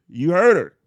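punchy shout of the catchphrase; loud call-out. Real, not acted. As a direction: belt it out like a hype man. Louder, higher third repeat.

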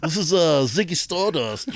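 high put-on rock star voice. Shown as a demonstration. Host continuing celebrity voices, mock impression. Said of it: "or I can do, like".

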